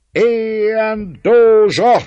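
very loud, high, slow roar; roaring crowd. A narrator's reading. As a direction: roar it like a thousand voices. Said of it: "ordított ezer meg ezer hang egyszerre".

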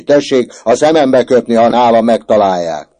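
put-on boy shrieking at the prefect. A narrator's reading. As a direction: screech the challenge. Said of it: "rikácsolt Böszörményi".

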